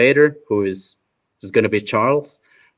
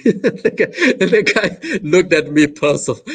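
laughing; 8 semitones higher than usual. Real, not acted. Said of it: "laughing at own story".

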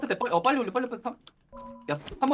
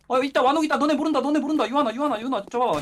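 higher, louder gleeful spotting; excited; 7 semitones higher, 5 dB louder than usual. Real, not acted. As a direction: crow over the find. Spots hidden enemy excitedly.

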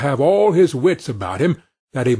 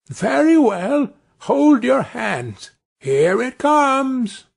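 much higher, slow, drawn-out tiny call; little Tom shouting to tip off the cook; calling out loud; 10 semitones higher, slower than usual. Acted. Tiny Tom calls out loudly to the thieves so the cook hears, high and slow. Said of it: "called out as loud as he could".

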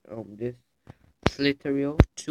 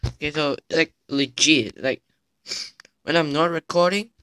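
excited; 3 semitones higher, 9 dB louder than usual. Real, not acted. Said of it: "gets worked up explaining".

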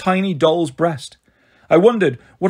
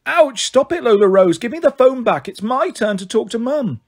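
put-on yelp, then demand the phone back like a cross kid; small boy squabbling with his sister over the phone, higher and indignant; indignant child; 7 semitones higher than usual. Kendall fights over the phone.

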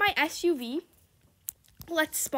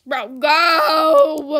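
louder bro go at the car ahead; shout; 10 dB louder than usual. Real, not acted. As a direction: yell at them to go. Yelling at a stalled car.

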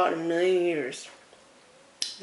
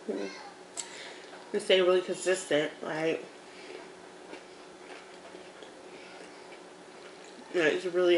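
talking between bites; eating while talking; mouth full, chewing; 5 dB quieter than usual. Real, not acted. Seen 3: Mukbang; hums with lips closed at start, eats food bits near end.

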